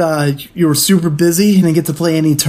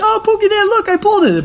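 much higher, gushing squeal; excited fan. Retold or imitated. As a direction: play the thrilled kid showing off a pull. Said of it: "everyone was like".

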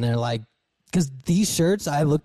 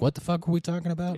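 confused solo host; 5 semitones higher, 4 dB quieter than usual. Retold or imitated. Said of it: "Be like".

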